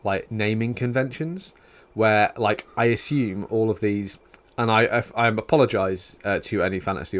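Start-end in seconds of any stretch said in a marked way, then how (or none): none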